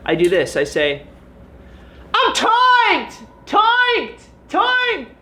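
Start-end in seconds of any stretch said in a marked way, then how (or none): 2.1-5.1 very high, loud falsetto shrieks; mock calm. Vlogger shows fans his calm, shrieking very high. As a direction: shriek it while calling it calm. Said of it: "See how calm that was?".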